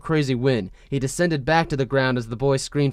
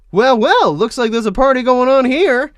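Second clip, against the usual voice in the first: high, loud cheerful greeting; 11 semitones higher, 8 dB louder than usual. Acted. Cheerful Wan voiced in reading.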